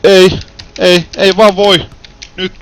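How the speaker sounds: loud, high repeated no; loud frustrated protest; desperate yell. Real, not acted. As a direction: cry out at the game, unable to believe it. Repeated failure, yelling at game.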